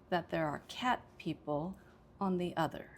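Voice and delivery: "cat", "people" and "other" stressed. Shown as a demonstration. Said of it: "I would say cat, people, and other".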